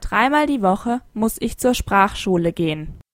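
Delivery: ordinary talk, nothing marked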